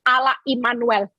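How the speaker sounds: ordinary delivery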